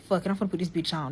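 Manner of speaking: thinking aloud to herself, soft and fast; inner voice. Retold or imitated. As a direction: say it fast under your breath, to yourself. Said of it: "That's what I'm saying in my head".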